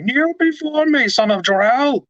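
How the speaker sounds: high-pitched commanding villain voice; General Zod. Retold or imitated. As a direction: order them to kneel, way up in pitch. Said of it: "General Zod problem".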